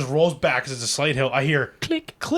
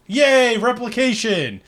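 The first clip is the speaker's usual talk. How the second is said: higher, louder mock cheer, then slow; sarcastic cheer; 6 semitones higher, 3 dB louder, slower than usual. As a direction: cheer it out with fake joy.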